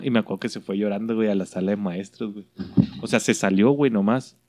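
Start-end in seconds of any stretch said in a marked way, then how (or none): none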